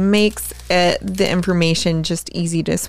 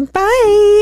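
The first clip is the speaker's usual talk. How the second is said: mock sign-off; 14 semitones higher, 6 dB louder than usual. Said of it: "trying to do the intro and outro".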